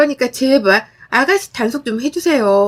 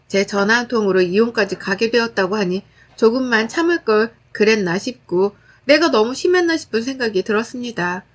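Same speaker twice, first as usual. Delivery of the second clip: ordinary delivery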